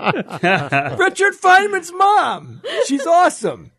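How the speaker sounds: very high, drawn-out gleeful cheer; delighted exclaiming. Real, not acted. Co-host delighted by a physicist's mom, high gleeful exclamation. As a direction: squeal it out with delight. Delighted after telling the quote.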